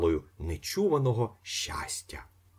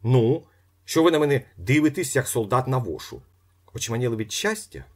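louder, fast pressing; impatient demand; 6 semitones higher, 6 dB louder than usual. A narrator's reading. Ostap pressing Ippolit for money, louder and fast. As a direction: push him hard.

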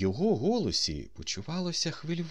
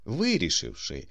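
plain reading voice

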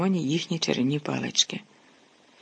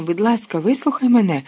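louder, higher, pleading exclamation; urgent call to be heard; exclaim; 5 semitones higher, 4 dB louder than usual. A narrator's reading. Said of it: "вигукнув Люпин".

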